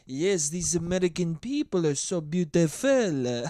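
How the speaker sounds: hammed-up bad French accent; cartoonish French accent. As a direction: gush like a French tourist, badly. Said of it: "It's the worst fucking French accent of all time".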